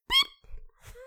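very high, thin electronic beep; imitated alarm beep. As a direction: chirp like a dying smoke alarm. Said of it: "It's just like".

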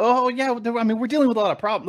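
tossing off problems as if trivial; mocking officials. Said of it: "They go".

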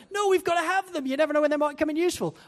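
high, hammed-up parent whine; parents protesting. Parents refusing to bin shell suits, hammed-up high whine. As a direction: squeal it like stubborn parents. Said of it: "and they".

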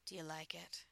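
ordinary delivery

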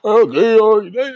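high, loud, put-on eager yes-man voice; mocking fake agreement. Mocking men who pretend to agree, in fake eager tones. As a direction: ham up a fake, over-keen agreement. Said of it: "everybody wanna act like".

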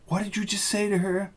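startled, asking in alarm; shocked question. Acted. Shocked at phone news.

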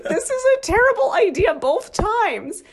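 high-pitched, incredulous retold reaction; amused disbelief. Recalling the clashing music to a friend, high amused disbelief. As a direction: pitch it way up, half laughing at the absurdity.

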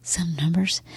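whispered, teasing reveal; mock secretive whisper. Wife pretending to share a shocking secret, whispering. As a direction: whisper it as if confiding.

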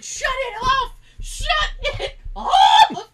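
high, loud laughing yell, drawn out slowly; mock furious yell stretched out; retold yell. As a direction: yell it across the house, laughing. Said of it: "I went".

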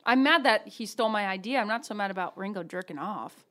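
ordinary delivery

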